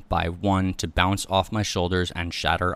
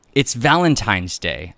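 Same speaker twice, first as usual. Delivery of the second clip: raised, slow, loud exasperated yell; angry yell; 3 semitones higher, 7 dB louder, slower than usual. A narrator's reading. Wife rowing with husband over Valentine's Day, yelling. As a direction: yell it at your late partner, louder and higher. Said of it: "I yelled".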